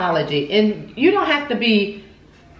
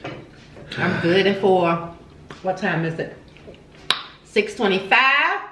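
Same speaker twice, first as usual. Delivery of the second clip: talking through a mouthful; smacking while chewing food; 4 semitones lower than usual. Real, not acted. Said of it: "why you smacking like that?".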